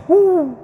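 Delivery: high, loud startled gasp; mock shocked gasp. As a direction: gasp as if scandalized. Shocked reaction to showing skin. (gespielt entsetztes hohes „huh“)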